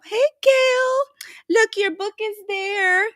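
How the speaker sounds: high, bright call to Gail about her book; delighted, showing off her book to the camera; excited greeting. Real, not acted.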